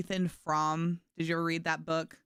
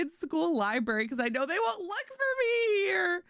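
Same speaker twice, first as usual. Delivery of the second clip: put-on casual teen reply; 12 semitones higher than usual. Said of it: "And I was like".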